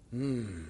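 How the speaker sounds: very low, soft hum; closed-lipped pious hmm. Acted. Scribes ending their question to Jesus, mock-concerned hum. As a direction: end on a quiet hmm, lips together. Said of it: "with the lips together and a hmm".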